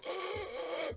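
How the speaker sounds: high, breathy choking gasp; gasping for air. Retold or imitated. Acting out the thug who can barely breathe, soft high gasp. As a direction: suck in air like a crushed windpipe. Retold victim struggling to breathe.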